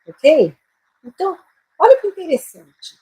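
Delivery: ordinary talk, nothing marked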